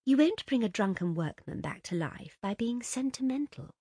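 usual speaking voice

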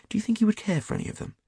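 fast and high, tumbling out; quick eager rush. A narrator's reading.

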